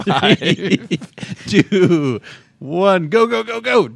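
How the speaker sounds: loud, high countdown yells; excited shouting. Real, not acted. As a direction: shout the start.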